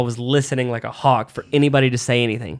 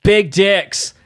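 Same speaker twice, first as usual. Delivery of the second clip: loud, raised-pitch mock heckle; heckler's shout; 6 semitones higher, 5 dB louder than usual. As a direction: blurt it out like a drunk audience member. Imitates drunk heckler shouting out.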